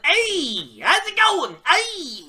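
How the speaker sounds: high, hammed-up Joe Pesci-style tough guy; mock a wrestler's promo with a high yelp; mock Eddie Kingston promo. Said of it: "He's Joe Pesci".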